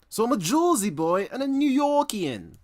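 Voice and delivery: very loud, high, hammed-up New York-Jersey accent; mock New York accent. Streamer boasting to chat of his roots, exaggerated New York accent. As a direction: shout it like a cartoon New Yorker. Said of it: "New York accent".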